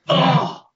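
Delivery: short, high grunt of pain; re-enacted pain yell. Earwig victim showing his co-host how he yelled, mock pain yell. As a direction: imitate a sudden yelp from a stab of pain. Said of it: "and I would yell".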